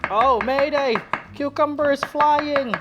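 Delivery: high, loud mock alarm, slow at the end; loud playful panic over a flying cucumber. Real, not acted. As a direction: cry mayday in playful panic. Cucumber slice flies off.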